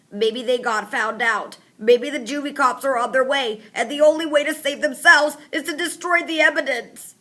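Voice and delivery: rising, high panicky whine; whiny panic. A narrator's reading. Mouth breather frets in crate, panicky whine. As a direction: spiral into panic, voice climbing.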